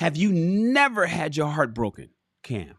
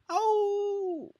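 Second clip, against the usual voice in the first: high, softer hound howl; dog howl; 5 dB quieter than usual. Retold or imitated. Hound dog asked why he stays put, howling. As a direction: howl long like a hound on a nail. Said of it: "the hound dog looks at him".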